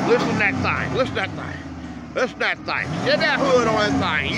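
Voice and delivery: loud, excited engine brag; hyped shouting. Real, not acted. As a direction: shout it out over the running engine. Hyping the truck's engine loudly.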